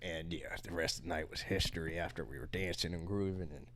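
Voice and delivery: low, quiet cowboy storyteller; deep cowboy voice. Acted. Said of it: "It's hard to go back to normal voice than this".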